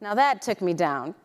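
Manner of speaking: ordinary talk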